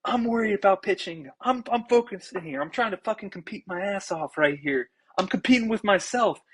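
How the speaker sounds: retold heated outburst at the coach; on edge. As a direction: snap back at the coach, wound up. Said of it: "I was on edge right now".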